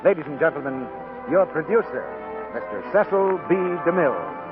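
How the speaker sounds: ordinary talk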